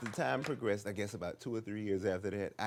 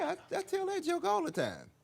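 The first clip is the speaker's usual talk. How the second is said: squeak. Retold or imitated. Comic squeaks defensively.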